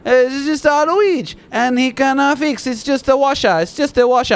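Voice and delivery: high, hammed-up Italian accent. Property manager insisting it's just a washer, high mock Italian accent. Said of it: "He's Italian".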